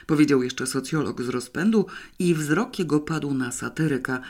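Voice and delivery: ordinary delivery, nothing marked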